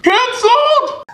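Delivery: high, stretched-out proclamation; dramatic cry. Acted. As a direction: annunciarlo come una tragedia teatrale. Dramatic announcement of cancelled event.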